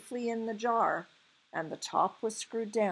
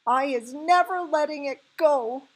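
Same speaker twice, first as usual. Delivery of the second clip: high, loud outcry; emphatic cry; 8 semitones higher, 10 dB louder than usual. A narrator's reading. BFG refusing to free the nightmare, crying out. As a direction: cry out with fierce resolve. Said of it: "the B F G cried".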